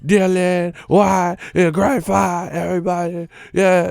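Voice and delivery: high, excited mocking fans; mock cheering. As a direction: hype it up like a crowd.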